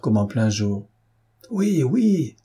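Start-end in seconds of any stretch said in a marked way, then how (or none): 1.5-2.4 much higher, eager cry; eager shout. A narrator's reading. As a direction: call out yes like an impatient crowd. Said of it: "cria-t-on".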